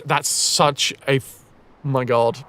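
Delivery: normal talking voice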